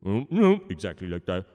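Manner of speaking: ordinary delivery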